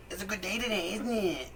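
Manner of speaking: high-pitched put-on chitchat; odd neighbor imitation. Strange neighbor making small talk with kids, high imitated voice. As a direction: mimic a strange man in a high voice. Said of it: "He'd be like".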